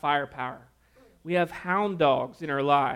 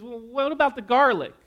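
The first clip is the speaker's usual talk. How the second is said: high, loud eager question; a boy pushing his mom for answers; excited kid; 7 semitones higher, 6 dB louder than usual. Acted. Son quizzes mom about the garlic, high and eager.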